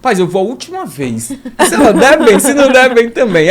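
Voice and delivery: loud laughing resolve; laughing as he decides on one last try. Retold or imitated. Laughing at his own hunger saga.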